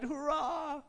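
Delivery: high, thin little hurrah; feeble child's cry. Acted. Tiny Tim cheering at the dinner table, feeble cry. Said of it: "feebly cried".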